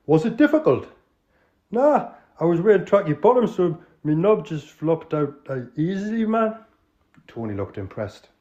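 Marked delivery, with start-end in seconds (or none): 0.1-0.9 loud Geordie call to order; Geordie accent. Acted. Tony calling the crooks to order, Geordie. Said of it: "an authentic, uh, Northerner". 1.7-6.6 put-on Geordie accent. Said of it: "an authentic, uh, Northerner".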